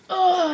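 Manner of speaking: loud annoyed ugh; frustrated groan. Retold or imitated. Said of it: "I'm just like".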